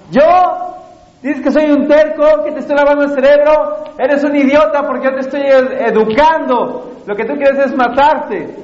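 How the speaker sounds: high-pitched, mock-angry parental tirade; acted shouting father. Father arguing back at his stubborn son, mock shouting. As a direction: play an indignant father raising his voice at his son. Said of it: "un papá gritándole al hijo".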